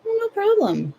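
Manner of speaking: high, breezy mock nonchalance; squeaky fake-casual no problem; sarcastic chirp. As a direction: brush it off in a sing-song. Sarcastic reply about selling organs.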